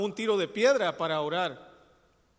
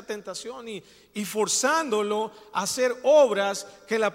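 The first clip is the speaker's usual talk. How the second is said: louder, building preacher's exhortation; preaching at full force; 3 semitones higher, 5 dB louder than usual. Acted. Pastor exhorts his congregation about works and faith, louder preaching. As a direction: drive the point home to the congregation.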